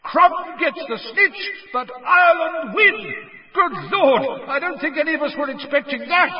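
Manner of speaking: high, astonished announcer's shout; taken aback. A narrator's reading. Bagman surprised by the match's sudden end, shouting. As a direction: call the result out in amazement. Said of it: "taken aback by the sudden end".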